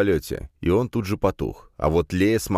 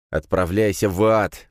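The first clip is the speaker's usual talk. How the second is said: through gritted teeth; 2 semitones higher than usual. A narrator's reading. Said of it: "процедил я".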